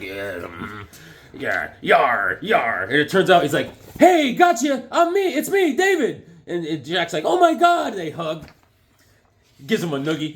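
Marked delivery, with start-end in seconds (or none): 0.0-2.8 put-on low growly old pirate voice; crusty pirate. Pirate answers Jack in a dream, crusty low voice. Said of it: "The guy's all crusty". 4.0-6.3 very high, loud, gleeful call; David's excited reveal. Acted. Pirate reveals himself to brother, excited high voice. 7.2-7.9 high, loud, astonished cry; amazed exclamation. Acted. Jack recognises his brother in a dream, amazed cry.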